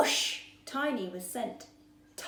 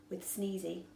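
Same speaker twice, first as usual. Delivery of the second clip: ordinary delivery, nothing marked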